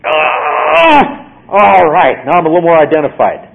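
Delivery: loud, high "Ah", then a fast smug line; mock self-satisfied. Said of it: "There is nothing we can do to go".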